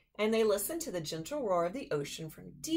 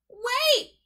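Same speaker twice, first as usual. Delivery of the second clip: high, loud shout; 9 dB louder than usual. Acted. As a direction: yell it after him. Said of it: "he shouted".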